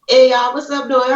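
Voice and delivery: thick New Orleans Southern accent. Shown as a demonstration. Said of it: "big portion of my life in New Orleans".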